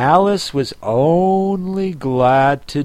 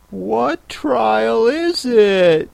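higher, slow, breathless question; panting; 8 semitones higher, much slower than usual. A narrator's reading. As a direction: ask while out of breath from running. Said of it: "Alice panted as she ran".

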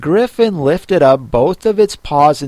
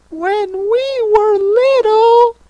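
very high, slow tearful turtle voice; sobbing a little; 5 dB louder, slower than usual. A narrator's reading. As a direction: draw it out in a high, plaintive wail. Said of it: "still sobbing a little".

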